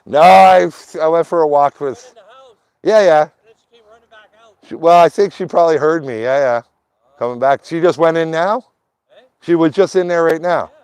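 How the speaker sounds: high and loud, calling across to neighbors; loud high greeting. Real, not acted. He tells the neighbors loudly and high that he went for a walk. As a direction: project up to the neighbors.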